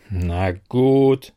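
low, grudging growl; growled agreement. A narrator's reading. Narrator gives in to the nagging desk, growling grudgingly. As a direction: give in with a drawn-out grumble. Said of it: "knurrte ich".

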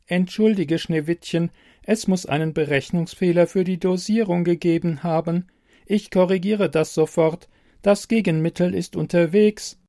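a helpful machine, evenly spoken; computer voice. Acted. Computer voice in story.

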